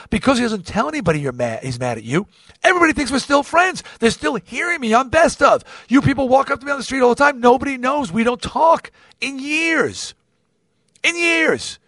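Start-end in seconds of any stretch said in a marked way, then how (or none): 2.6-11.8 high, insistent protest, drawing out the last words; exasperated venting. Real, not acted. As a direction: vent about a secret rift, pitched way up. Venting that nobody knows they don't talk.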